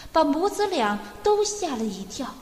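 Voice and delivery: plain reading voice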